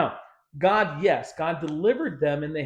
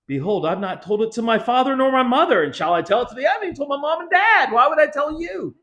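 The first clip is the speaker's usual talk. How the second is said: louder, quick defensive retort; lightly mocking petulant husband; defensive Samson; 7 semitones higher, 4 dB louder, faster than usual. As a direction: fire it back, fast and huffy. Voicing Samson's reply.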